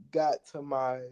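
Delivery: usual speaking voice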